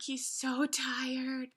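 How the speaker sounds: slow, drawn-out and weary; weary sympathy. Retold or imitated. Reader on the worn-out bear mothering goslings, weary. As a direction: stretch it out with tired sympathy.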